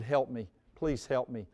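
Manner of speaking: low, soft begging; quiet plea. Retold or imitated. Father begging friend for help, low soft plea. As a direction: plead softly, worn out.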